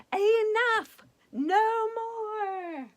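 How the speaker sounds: high loud fairy yell. Acted. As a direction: yell it in frustration. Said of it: "The fairies yelled".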